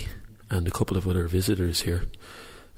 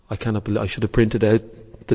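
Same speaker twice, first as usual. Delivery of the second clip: usual speaking voice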